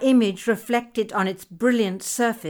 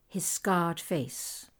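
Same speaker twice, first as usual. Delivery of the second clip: ordinary delivery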